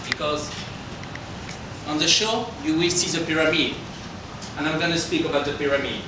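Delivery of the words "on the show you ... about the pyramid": normal talking voice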